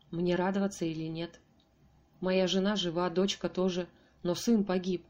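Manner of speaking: usual speaking voice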